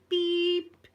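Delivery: high loud beep in place of a swear word; mock censor bleep. Mum bleeping her dad's swearing, high loud beep.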